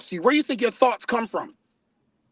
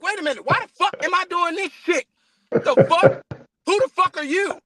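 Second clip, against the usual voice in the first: exasperated outburst; 6 semitones higher than usual. Retold or imitated. Inner voice confronting intrusive people.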